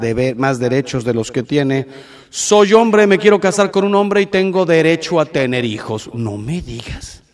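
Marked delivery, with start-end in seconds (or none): 2.3-6.1 imitating a claimant. Voicing people claiming rights. 6.1-7.2 sarcastic dismissal. Real, not acted. Sarcastic reply to claim.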